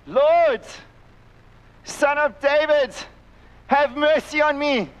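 loud pleading cry, pitched high. Retold or imitated. Desperate mother crying out to Jesus in public, loud. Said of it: "she's going".

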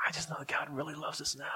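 whispered, retold tearful confession; hushed whisper. Weeping teenager answers his youth counselor, hushed whisper. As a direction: repeat it in a barely voiced whisper.

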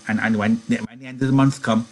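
lightly voiced stammering, eager man; prompting Lennie. A narrator's reading. Lenny prompts George's familiar speech.

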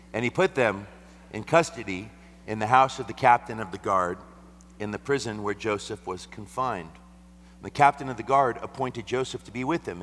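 loud, high reading of scripture. Acted. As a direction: read it out boldly to the church.

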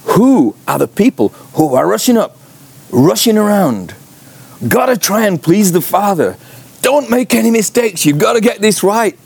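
high, hurried fretting servant voice; anxious fussing servants. Retold or imitated. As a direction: fret like a servant afraid to fail. Servants anxiously rushing around.

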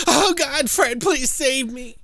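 very high, breathless, slow mock plea; hammed-up damsel in distress; helpless damsel. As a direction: ham up a damsel begging to be rescued. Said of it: "they do make that very apparent when she's like".

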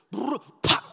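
loud brr pop imitation; loud imitated engine pop; engine noise. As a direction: mimic the truck lurching.

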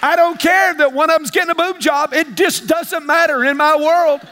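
fired-up preaching. Real, not acted. Preacher asks the church if they're with him, fired-up. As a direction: keep the heat up and press the crowd.

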